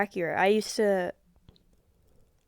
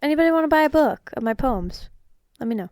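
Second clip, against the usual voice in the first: higher, louder playful pitch; mock sales pitch; 6 semitones higher, 6 dB louder than usual. Real, not acted. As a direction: call it out like a street seller.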